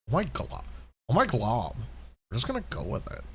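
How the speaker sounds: lightly voiced bratty princess; LSP impression. Host doing a light Lumpy Space Princess voice. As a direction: toss it off like a spoiled cartoon princess. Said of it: "channel my inner L S P".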